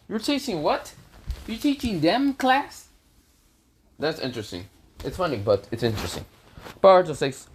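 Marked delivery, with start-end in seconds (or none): 0.1-2.9 high-pitched disbelieving questions; incredulous. Acted. Naruto to Boruto who is teaching a class, incredulous.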